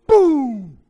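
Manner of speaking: huge high shouted blast; explosive boom. Acted. Husband acting out his wife exploding, explosive boom. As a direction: boom it out like a bomb going off.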